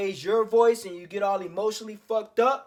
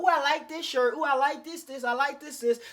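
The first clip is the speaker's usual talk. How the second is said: mock girlfriend voice; 5 semitones higher than usual. Girlfriend browsing his closet, voiced.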